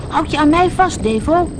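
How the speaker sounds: high, loud order to a friend; urgent call. A narrator's reading. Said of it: "riep U K".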